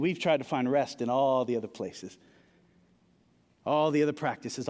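ordinary delivery

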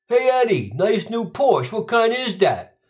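sly ribbing of a buddy; mock neighbour.

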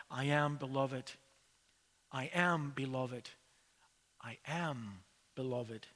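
quiet, fading murmur to herself; softly repeating. Retold or imitated. Beaten woman alone in hospital room, softly repeating to herself. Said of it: "softly speaking and repeating to herself".

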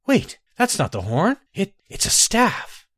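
high, halting disbelief; shocked realization. Acted. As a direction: stumble over the shock. Shocked discovery.

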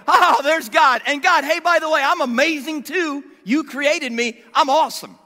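loud, high mock bragging; cocky boasting. Mocking a boastful Isaiah before God.

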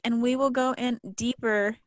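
ordinary talk, nothing marked

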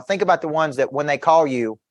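normal talking voice

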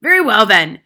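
very loud, forceful concession; domineering headmistress; grudging. A narrator's reading. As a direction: concede at full volume.

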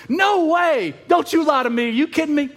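a woman hearing she'll have a son, amazed; amazed disbelief. Retold or imitated. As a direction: play her amazed, can't believe it. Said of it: "she's so excited and so amazed".

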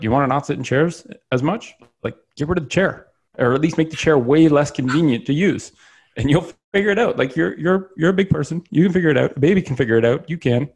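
animated, high-pitched nudge to a client; insistent coaxing. Retold or imitated. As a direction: coax them as if it's obvious. Voicing blunt advice given to clients.

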